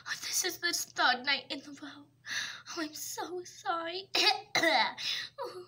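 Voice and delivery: normal talking voice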